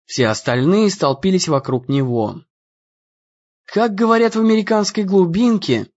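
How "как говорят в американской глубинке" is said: high-pitched, amazed exclamation; mildly astonished, raised voice; gasping amazement. A narrator's reading. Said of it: "ахнула Натали".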